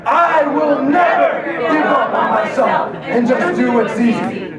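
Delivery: loud, slow, high chant; shouted vow. Shown as a demonstration. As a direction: push the promise out hard. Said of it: "Say it again".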